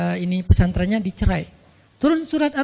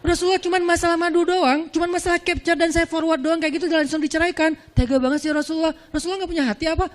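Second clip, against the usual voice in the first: mock complaint; 6 semitones higher than usual. Imagined wife complaining to Prophet.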